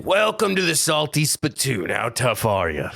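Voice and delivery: quoted bouncer challenge, slowing at the end; cartoon bouncer. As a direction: greet them like a bouncer at the door. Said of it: "the bouncer, shall I say, was saying".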